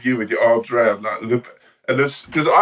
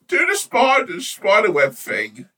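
starstruck pleading. Pretend. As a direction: beg your hero like a child. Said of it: "sort of, like".

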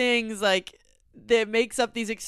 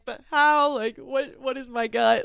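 louder, higher retold protest; exasperated questioning; 4 semitones higher, 7 dB louder than usual. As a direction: plead in mock exasperation. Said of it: "And I'm like".

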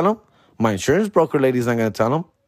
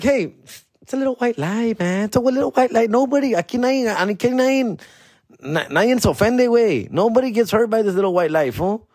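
pitched way up, breezy excuse-making; high mock self-voice; 10 semitones higher than usual. Comedian voicing his past self brushing off a lie, high and breezy. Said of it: "I'm like".

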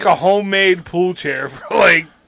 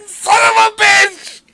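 high, slow exasperated yell; mock-frustrated outburst; frustrated shout; 4 dB louder, slower than usual. Real, not acted. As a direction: curse it out loud in mock frustration. Failing to tell a joke.

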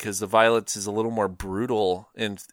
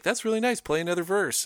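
high, falsely gentle praise before the smash; lightly voiced menacing charm; mock-sweet hangman; 9 semitones higher than usual. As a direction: coax her sweetly, high and fake-nice. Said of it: "he's like".

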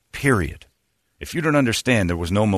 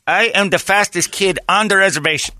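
put-on Navajo accent; 3 semitones higher than usual. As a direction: boast in an imitated Navajo accent. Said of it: "That's how the Navajo basketball".

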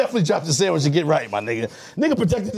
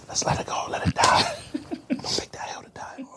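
hushed, voiceless whisper; whispered plea; 5 semitones higher, 7 dB quieter than usual. Real, not acted. Friend begging to let the joke die, whispered. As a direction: whisper it, wanting the subject dropped.

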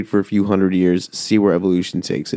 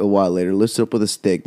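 ordinary talk

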